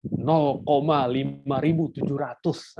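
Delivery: slow, teasing mock reading; playful wrong reading. Shown as a demonstration. Teacher to students, mocking a silly reading, slow and teasing. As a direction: tease the absurd reading out. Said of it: "tambah iseng lagi".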